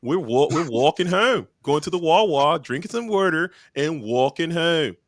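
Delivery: put-on easy Philly accent with high lilt. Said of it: "That's my Philly accent".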